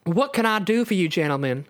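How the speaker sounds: southern belle voice; mellifluous southern drawl. Acted. Rogue asks the two men, in a southern drawl. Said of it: "in her mellifluous southern drawl".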